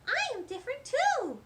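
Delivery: very high, loud squeaky hedgehog. Acted. Hedgehog Dill Prickle joining in, very high and loud. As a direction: squeak it out bravely. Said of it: "said Dill Prickle".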